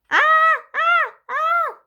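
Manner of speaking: high, loud playful scream; clown scream. Acted. Child scaring Grandma, high loud mock scream. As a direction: shriek high to scare Grandma. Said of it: "I screamed".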